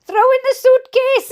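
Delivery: loud, high shout of an impatient dad; dad shouting to mum to throw in the case. A narrator's reading. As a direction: yell it through the open car door. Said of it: "he shouted".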